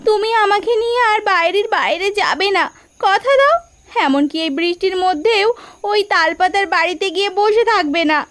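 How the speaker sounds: high, insistent pleading close to tears; tearful pleading. Acted. Wife in tears begging her husband to promise. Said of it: "কাঁদছো কেন তুমি?".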